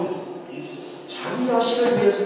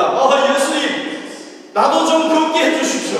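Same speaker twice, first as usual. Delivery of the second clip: pleading Peter; 9 semitones higher, 5 dB louder than usual. Acted. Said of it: "예수님에게 요청을 합니다".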